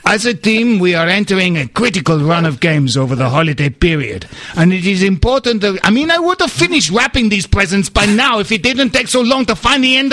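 calm mock Portuguese footballer accent; Luis Boa Morte accent. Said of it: "by attempting the accent".

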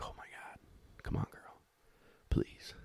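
urgent hushed whisper begging the dog; pleading whisper to the dog; whispered plea. Retold or imitated. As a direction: breathe it out, pleading under your breath.

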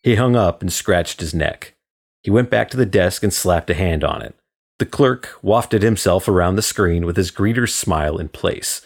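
ordinary delivery, nothing marked

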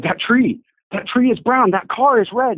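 high-pitched amazed naming of colors; excited discovery. Retold or imitated. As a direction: point out each thing in wonder. Woman suddenly seeing colors.

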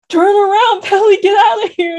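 mock urgent warning. Mando warning Peli off the battle, mock urgency. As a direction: call out urgently, half laughing. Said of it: "And then him being like".

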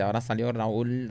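slow, soft murmur to a neighbour; hushed aside. Acted. Companion describing the play to a blind man, hushed aside. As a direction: murmur it discreetly, taking your time.

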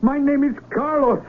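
high, slow defiant shout; shouting back. Acted. Carlos shouting back at the villagers, indignant and high. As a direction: throw it back at them, indignant.

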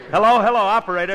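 calling loud and fast for the operator; hurried loud hello to the operator; excited call. Real, not acted. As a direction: shout eagerly into the phone.

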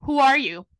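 loud retold challenge, falling at the end; retold confrontation. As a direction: demand it loudly, imitating the guard. Guard confronts her in retelling.

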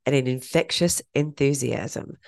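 measured, polished intro read; scripted podcast intro. Acted. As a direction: present the episode in neat, evenly paced phrases.

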